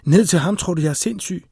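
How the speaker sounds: acted agitated protest; indignant refusal. A narrator's reading. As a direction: throw it back in disbelief. Woman refuses to go back down.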